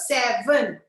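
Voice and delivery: "seven" stretched into two distinct beats; slow, emphatic "seven", each syllable given weight; two-syllable demo. Shown as a demonstration. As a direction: separate both syllables of "seven" slowly. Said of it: "It's a two-syllable word".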